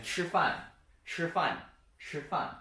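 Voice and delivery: "吃饭" repeated, high 吃 falling on 饭; first-tone demo. Shown as a demonstration. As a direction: model "吃饭" word by word, 吃 high and level. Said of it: "So the first one".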